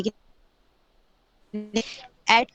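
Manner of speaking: choppy online call audio; words dropping out over bad connection; voice cutting out. Real, not acted. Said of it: "आपकी आवाज़ नहीं आ रही".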